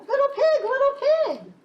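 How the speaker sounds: high, loud sing-song call; wolf calling the pig, high and loud. Acted. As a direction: call sweetly through the door. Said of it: "so I called".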